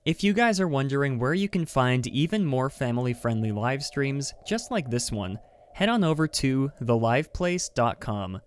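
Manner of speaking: upbeat ad read, pitched a little up; lively live-stream sponsor spot. Acted. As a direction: sell the sponsor like a cheerful host. Reads sponsor ad segment.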